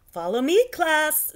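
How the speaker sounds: teacher calling her class to follow; cheerful command. Acted. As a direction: lead the class boldly. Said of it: "said the Frizz".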